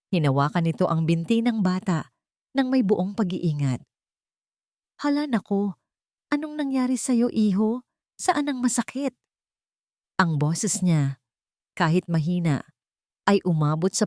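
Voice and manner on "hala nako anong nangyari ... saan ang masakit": put-on a stranger kneeling to comfort a hurt boy; lightly voiced woman, tender and worried; gentle caring woman. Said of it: "Mahinahon at puno ng pag-aalaga".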